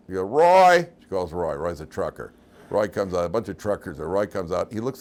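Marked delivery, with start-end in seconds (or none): 0.2-0.9 loud, high holler across a room; calling out. Retold or imitated. Truck stop lady calls for Roy, hollering. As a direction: holler for someone out back.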